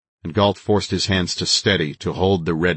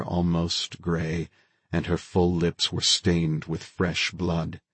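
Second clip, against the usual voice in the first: plain reading voice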